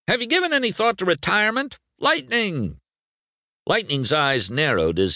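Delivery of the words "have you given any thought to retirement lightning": fast, high-pitched reporter clamour; barked question. Acted. Reporters barking questions at Lightning, high urgent shouting. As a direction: rattle it out and call his name. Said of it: "They barked his name along with".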